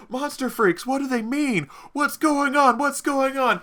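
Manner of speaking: high, panicked crowd voice; panicked townsfolk. Acted. Panicked townspeople questioning the heroes.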